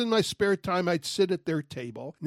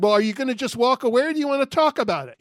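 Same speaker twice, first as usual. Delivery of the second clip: fast, raised call after someone walking away; shouted after him; 6 semitones higher, 4 dB louder, faster than usual. Retold or imitated. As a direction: call out quickly, a bit higher and louder. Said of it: "but I shouted after him".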